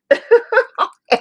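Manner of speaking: high, loud burst of laughter. Real, not acted. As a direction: laugh out loud at a dried-up pen. Pen is out of ink.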